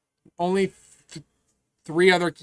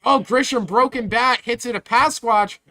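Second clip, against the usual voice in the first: excited, louder sportscaster call; play-by-play; 6 semitones higher, 6 dB louder than usual. Pretend. Mock live baseball play-by-play.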